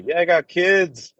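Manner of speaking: normal talking voice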